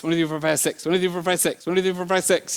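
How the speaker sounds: quick, run-together counting; rapid counting. Shown as a demonstration. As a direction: count the kids as fast as you can. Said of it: "counting to six as fast as I can".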